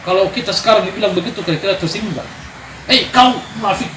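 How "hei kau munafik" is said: mock rebuke. Quoting Jesus' rebuke.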